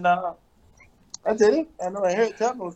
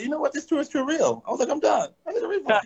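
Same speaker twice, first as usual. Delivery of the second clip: high, whiny mock complaint; pretend-tearful high plea to quit the tour; mock distressed tourist; 8 semitones higher than usual. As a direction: beg for a refund in a hammed-up high voice. Retelling quitting cotton tour.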